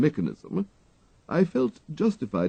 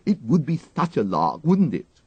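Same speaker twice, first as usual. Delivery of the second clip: put-on higher, quick mischievous girl; gleeful schoolgirl; 8 semitones higher, 5 dB louder than usual. A narrator's reading. The girl gleefully tells the valet about the lecture, mischievous child. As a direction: relish the lark, breathless child.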